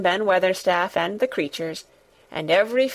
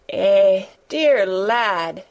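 tremulous; 4 dB louder, much slower than usual. A narrator's reading. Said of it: "she broke out tremulously".

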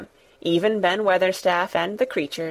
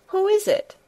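startled; 11 semitones higher than usual. A narrator's reading. Startled boy asks again.

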